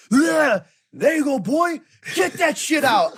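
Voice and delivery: mock hype-up shout. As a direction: bellow it like a psychopath cheering himself on. Friend hyping himself while vomiting.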